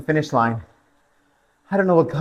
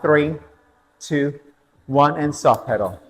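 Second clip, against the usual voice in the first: spin instructor cooldown; 2 semitones lower than usual. Real, not acted. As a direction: bring them down gently from the sprint. Spin class countdown and cooldown.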